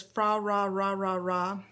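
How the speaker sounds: FRA RA RA RA RA with R sounds in place of L; sing-song syllables swapped to R, "Fra Ra Ra Ra Ra", drawn out; movie-style mispronounced carol. Retold or imitated. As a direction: slowly quote the garbled carol refrain as "Fra Ra Ra Ra Ra". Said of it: "they say "Fra Ra Ra Ra Ra" instead of".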